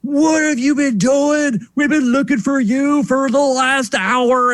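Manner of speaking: mock grandpa scolding, drawn out; scolding grandfather. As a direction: play the grandfather telling the kids off, slowly. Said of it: "My grandfather laid into us".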